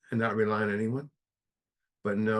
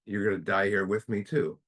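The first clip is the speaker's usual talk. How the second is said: ordinary talk, nothing marked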